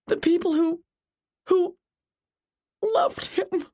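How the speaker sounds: high, sobbing accusation through tears; crying character, high and anguished; tearful outburst. A narrator's reading. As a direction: let grief push the voice way up, faltering at the end. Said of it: "Jennifer burst into tears".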